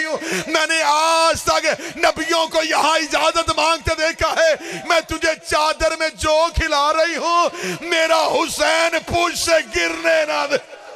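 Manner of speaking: high, drawn-out lamenting plea; tearful plea. Acted. Mother entrusting her son to the horse, tearful plea. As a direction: plead at high pitch, stretching the words. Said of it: "रो कर दी".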